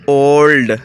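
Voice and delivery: اولڈ with a long, loud O; O sound. Shown as a demonstration. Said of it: "او کی آواز آ رہی ہے". (اولڈ میں لمبی او کی آواز)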